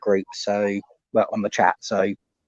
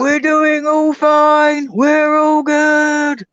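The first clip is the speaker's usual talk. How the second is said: high, very slow drawn-out holler; mock calling out; 8 semitones higher, much slower than usual. Answering friend's caps lock joke, drawn-out mock shout. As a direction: hold each word long and high, playing along.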